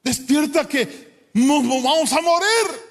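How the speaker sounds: high, urgent mock panic; panicked disciples. Disciples waking Jesus in the storm, high and panicked. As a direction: plead to wake him before you sink. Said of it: "llegan y le dicen".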